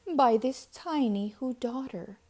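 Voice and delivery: ordinary delivery, nothing marked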